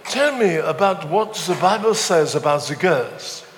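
comic put-on French accent. Frenchman asking what the Bible says, French accent. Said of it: "this is my French accent".

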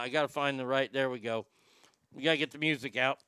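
talking around a dip; words blurred by chewing tobacco; lip full of dip. Real, not acted. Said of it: "Yes, I have a dip in my mouth".

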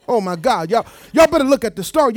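ordinary delivery

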